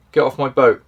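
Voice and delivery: loud warning shout, not very threatening. Shown as a demonstration. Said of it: "so I could literally shout".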